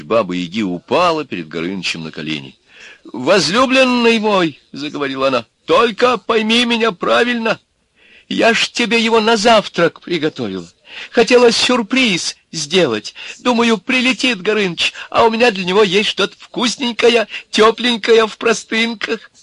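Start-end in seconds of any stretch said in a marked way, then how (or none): 3.0-4.6 очень высокий, протяжный женский голос; громко и тонко взывать к возлюбленному; умоляющая обольстительница. A narrator's reading. Упала на колени перед Горынычем. 5.6-19.3 тонкий, очень высокий, громкий голосок; оправдываться перед грозным возлюбленным на самых высоких нотах; заискивающая женщина. A narrator's reading. Оправдывается на коленях перед змеем.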